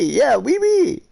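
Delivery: very high, slow and stretched "wee wee"; silly squeaky wee wee. Acted. Teasing a friend about French oui, in a very high squeal. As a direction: squeal "wee wee" like a toddler, drawn out.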